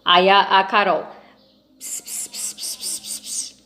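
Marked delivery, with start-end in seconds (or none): 1.8-3.6 hushed whispered gibberish; whispering. Acted. Carol telling Maluquinho the secret, whispering. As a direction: whisper "ps, ps" as gossip.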